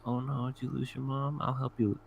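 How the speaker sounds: mock gentle concern; retold concern. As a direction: imitate someone soothing a lost kid. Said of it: "And he goes".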